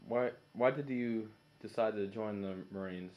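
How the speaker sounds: ordinary talk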